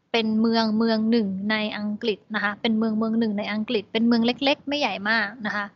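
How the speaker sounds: ordinary talk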